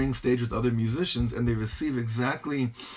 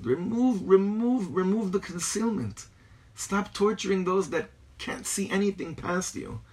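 insistent, higher-pitched repeated appeal; Rebbe pleading with nature; 9 semitones higher than usual. Retold or imitated. Rebbe talking to nature, acted insistent plea. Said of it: "A Rebbe is talking to nature".

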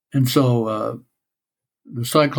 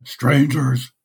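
Cyclops voice. Acted. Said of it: "this is my Cyclops voice".

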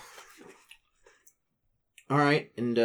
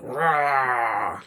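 a more modest bear roar; huge fiery dragon roar. Acted. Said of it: "That sounds terrible".

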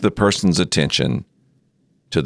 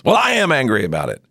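quick, higher snap back; defensive retort; 8 semitones higher, faster than usual. Retold or imitated. Angry ranter answering the counselor, quick and defensive. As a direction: blurt it out defensively.

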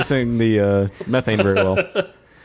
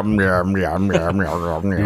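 imitating a cat; 6 semitones lower, much slower than usual.

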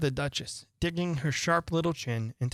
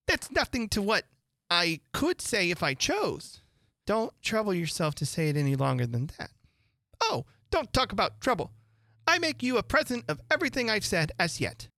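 lightly raised, preachy duchess; moralizing old noblewoman, sing-song; fussy Duchess voice; 7 semitones higher than usual. A narrator's reading. Duchess voiced in read story.

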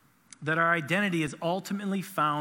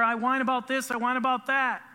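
imitating a child's whine, higher; a hint of a whining child; mock whiny kid; 7 semitones higher, 3 dB louder than usual. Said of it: "They constantly whine".

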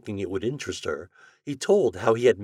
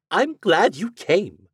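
lady's maid; 10 semitones higher, 8 dB louder, slower than usual. Acted. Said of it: "she said".

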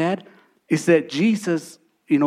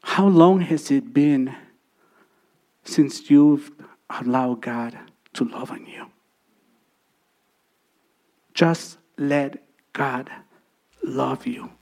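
tender altar call; 3 semitones lower than usual. Acted. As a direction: draw them in gently, almost whispering. Quiet altar call, softening to a whisper.

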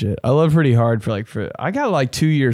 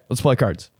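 ordinary talk

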